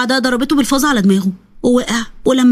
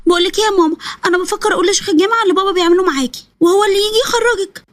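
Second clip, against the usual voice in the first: put-on higher, earnest little girl's voice; a worried child confiding a plan to her mother; young daughter; 7 semitones higher than usual. Daughter urging her mother to get help, high childlike voice.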